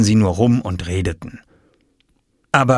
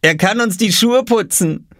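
put-on much higher, cheeky boy voice; teasing; 12 semitones higher, 3 dB louder than usual. A narrator's reading. Brother joking Oskar could shine shoes, cheeky. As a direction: poke fun at him.

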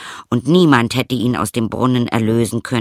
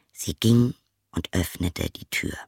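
whisper; 4 semitones lower, 5 dB quieter than usual. A narrator's reading. Hushed narration moment.

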